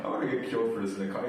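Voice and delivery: fast, laughing through the words. Real, not acted. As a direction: giggle while talking. Laughs at own joke.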